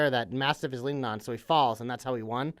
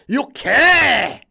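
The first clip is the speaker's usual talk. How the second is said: loud, high hammed-up Scottish shout; Gallus Scottish accent; 8 semitones higher, 10 dB louder than usual. Shown as a demonstration. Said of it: "the fucking Gallus accent".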